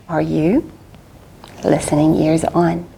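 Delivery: ordinary delivery, nothing marked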